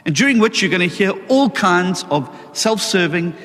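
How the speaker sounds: ordinary talk, nothing marked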